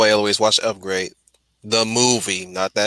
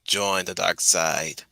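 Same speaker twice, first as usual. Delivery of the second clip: dark side voice; 5 semitones lower, 6 dB quieter, slower than usual. Pretend. Sith tempter voice.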